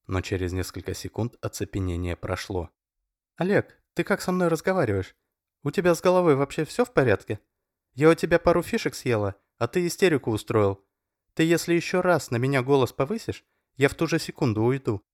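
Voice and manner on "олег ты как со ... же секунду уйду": much higher, louder, fast indignant protest. A narrator's reading. Masha furiously confronts Oleg, much higher and louder. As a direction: put him in his place.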